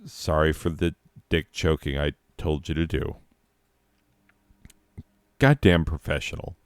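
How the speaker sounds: ordinary talk, nothing marked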